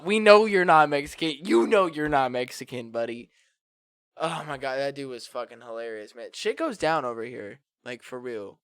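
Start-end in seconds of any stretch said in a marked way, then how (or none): 0.0-3.3 loud, insistent retort; raised-voice exasperated correction; emphatic mock rebuttal. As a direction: set him straight, loudly and comically.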